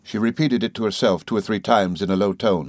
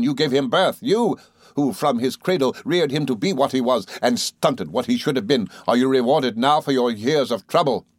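furious accusation; 4 semitones higher than usual. Acted. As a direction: hurl it at the mother like a curse. Rosa attacking grieving mother.

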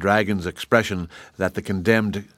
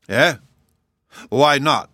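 sharp, higher startled question; surprised; 5 semitones higher, 4 dB louder, slower than usual. Acted. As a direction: caught off guard, louder. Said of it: "asked the king in surprise".

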